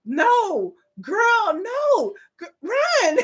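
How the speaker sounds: high frantic no, no, no, run; warning a horror victim, voice climbing high; laughing panicked plea to a character on TV; pleading at the screen. Retold or imitated. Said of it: "I'm just like".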